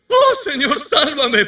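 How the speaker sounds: hammed-up sinking plea; mock desperate cry. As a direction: Pedro sinking, crying to the Lord, mock panic.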